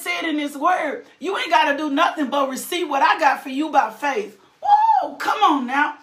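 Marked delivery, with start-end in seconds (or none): 4.6-5.2 sudden high preacher's whoop; high ecstatic whoop. Real, not acted. Preacher whooping to the congregation, high joyful cry. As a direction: cry out in joy.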